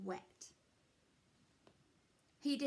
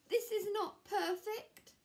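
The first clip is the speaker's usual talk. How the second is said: much higher, louder frustrated line; upset Square; 11 semitones higher, 5 dB louder than usual. Acted. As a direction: complain up high, let down. Square fails at carving.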